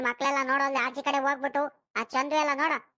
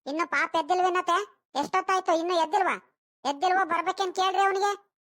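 high, loud impatient questioning; raised voice, demanding an answer; calling out crossly; 5 semitones higher, 4 dB louder than usual. Acted. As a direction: call through the house to the mother-in-law. Mother calls out, checking on sleeper.

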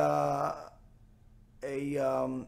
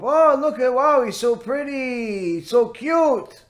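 high, drawn-out cooing over a pet; delighted wife; 6 semitones higher, slower than usual. Acted. Wife first sees the new parrot, mock delighted gushing. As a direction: gush slowly in a higher pitch. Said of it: "as soon as the wife saw him".

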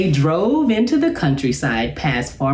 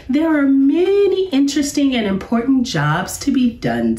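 professor voice climbing much higher with delight; excited professor; 6 semitones higher than usual. Acted. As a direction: sell the arts to the cubs with rising glee. Professor lists artistic jobs, rising.

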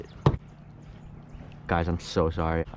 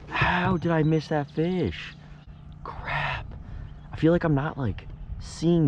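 real dismay; 10 semitones higher, slower than usual. Real, not acted. Dwelling on the missed fish.

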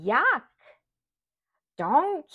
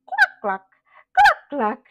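high, loud playful clucks; hen clucking; 12 semitones higher, 10 dB louder than usual. Shown as a demonstration. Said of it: "What does a hen say?".

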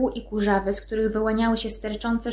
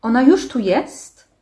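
louder, drawn-out startled question; cried out; 2 semitones higher, 5 dB louder than usual. A narrator's reading. Trurl to the mayor as the walls shake, crying out. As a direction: cry it out slowly, rising at the end. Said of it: "krzyknął Trur".

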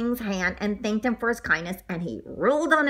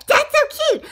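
high, loud gushing; squeaky delight; 6 dB louder than usual. Acted. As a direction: squeal it as if utterly charmed. Squeaky delight after giggling.

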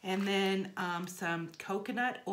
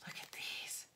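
hushed and charmed, almost no voice; awed whisper; 11 dB quieter than usual. Real, not acted. Vlogger showing viewers the eye mask, hushed whisper. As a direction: whisper with delight over the gift.

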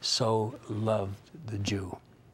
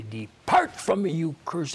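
stern judgment; 5 semitones higher, 4 dB louder than usual. Acted. God condemning persecutors at judgment.

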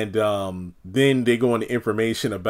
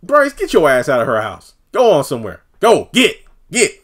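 louder, rising shoo; shooing him out; 4 semitones higher, 6 dB louder than usual. Retold or imitated. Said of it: "And it's like".

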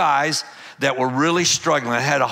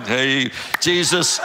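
laughing prayer; slower than usual. Real, not acted. Amused aside to God.

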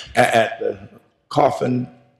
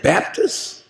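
disbelieving; 3 semitones higher, 4 dB quieter than usual. Retold or imitated. Baffled newcomer asks in disbelief.

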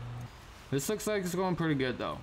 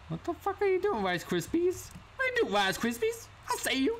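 high exasperated yelp. Real, not acted. Yelling at companion Rice Krispies.

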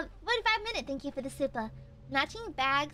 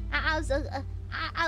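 usual speaking voice

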